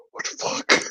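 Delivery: whispered retold disbelief; soft, voiceless imitation of shock; stunned whisper. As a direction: mouth it under your breath, mock-stunned. Retold shocked reaction under breath.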